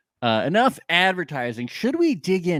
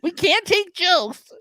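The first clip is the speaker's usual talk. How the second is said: high, mock-indignant complaining voice; hammed-up hurt Texan; offended Texan reviewer; 12 semitones higher than usual. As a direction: whine like an offended Texan fan. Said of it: "one-star reviews from people from Texas".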